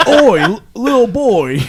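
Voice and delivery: loud, high Cockney call; Cockney guard. Acted. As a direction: open on "oi" and keep it Cockney. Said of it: "start with the phrase with "oi," and I can stay in Cockney".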